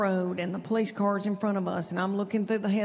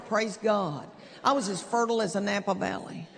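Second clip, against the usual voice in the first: ordinary delivery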